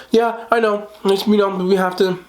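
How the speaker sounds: high mock doctor voice; doctor's reply. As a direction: play the doctor shrugging it off. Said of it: "And she's gonna go".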